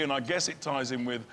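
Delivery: ordinary talk, nothing marked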